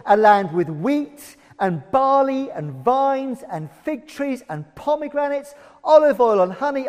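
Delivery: loud, expansive reading of a rich promised land; scripture reading. Acted. Preacher reading Deuteronomy to the congregation, loud and savouring. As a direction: savour each good thing as you read. Reads Bible passage aloud.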